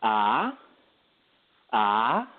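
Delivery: Ah sliding upward like a question; second tone, rising. Shown as a demonstration. Showing the host Mandarin's rising tone, rising ah. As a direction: let ah rise steeply at the end. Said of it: "it sounds something like this".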